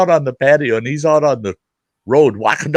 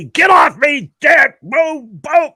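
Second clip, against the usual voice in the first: much higher, louder yelling with the punches; son's yell; 5 dB louder, slower than usual. Retold or imitated. Son pinned on the couch yelling at his dad, high loud yell.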